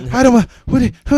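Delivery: a lightly voiced confused friend; flustered confusion. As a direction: play her lost for words. Ashley voiced confused, stammering.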